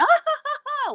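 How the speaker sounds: high fake cackle into a camera; mock loud laugh. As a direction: laugh like you're showing off for the camera. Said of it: "I'm talking like this".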